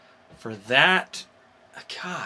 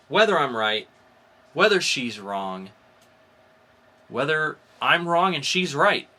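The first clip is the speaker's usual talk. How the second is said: upbeat host sign-off, a touch louder; podcast outro; 3 semitones higher, 7 dB louder than usual. Acted. Host plugs the show to listeners, upbeat sign-off. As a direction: sell the next episode to listeners.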